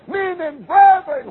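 loud, high, slow plea; crying out. Retold or imitated. A stirred sinner begging to be saved, loud high outcry. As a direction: cry out like a convicted sinner. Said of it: "cause a man to cry out".

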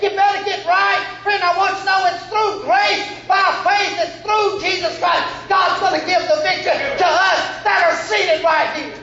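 high, ringing preacher's cry; full-force preaching. Real, not acted. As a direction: drive it home to the church.

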